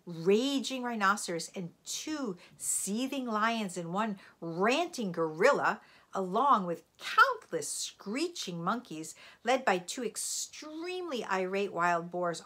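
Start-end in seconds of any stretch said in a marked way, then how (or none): none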